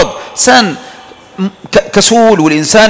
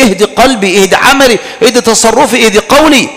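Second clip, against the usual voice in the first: high, earnest supplication to God; pleading prayer; 6 semitones higher than usual. Shown as a demonstration. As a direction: beg God for guidance. (دعاء متضرع بصوت مرتفع)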